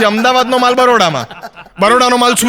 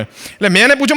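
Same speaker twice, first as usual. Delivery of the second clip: ordinary talk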